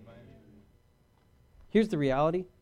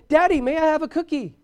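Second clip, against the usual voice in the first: high, loud mock bratty kid; griping child; 10 semitones higher, 6 dB louder than usual. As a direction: pester dad in a raised child's voice. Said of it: "they've been griping and complaining".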